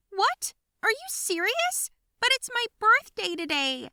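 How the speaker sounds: very high, loud disbelief; can't believe they'd do this; girl protesting on her birthday; shocked outrage. Acted. Plans cancelled on birthday.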